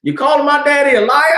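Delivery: bristling, shouting at the accusation; defensive coworker yelling; offended yell. Retold or imitated.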